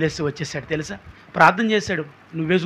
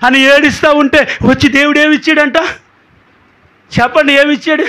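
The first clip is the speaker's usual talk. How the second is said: higher, louder, rising preacher's appeal; fervent preaching; 7 semitones higher, 6 dB louder than usual. Acted. Preacher appeals to the congregation with mounting fervour. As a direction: hammer each question home to the crowd.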